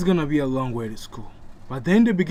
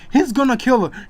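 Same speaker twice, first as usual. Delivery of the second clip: higher, louder frantic yell; panicked shout; 8 semitones higher, 5 dB louder than usual. A narrator's reading. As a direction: scream for the parents in panic.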